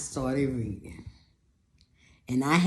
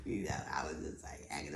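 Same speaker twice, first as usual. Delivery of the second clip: whispered aside; 12 semitones lower, 15 dB quieter, faster than usual. Real, not acted.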